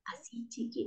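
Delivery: tiny whispered voice, barely audible; voz bajita. Shown as a demonstration. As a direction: drop to a very soft whisper. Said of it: "un tono de voz bajito". (susurro muy bajito)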